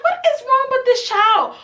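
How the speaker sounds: put-on outraged squeal at a lying kid; exasperated disbelief. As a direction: throw your hands up in shrill disbelief. Quoting her own outrage.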